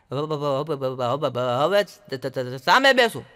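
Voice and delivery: a stammerer asking people to sit opposite; stammering. Retold or imitated. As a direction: keep restarting the phrase. Stammerer announcing.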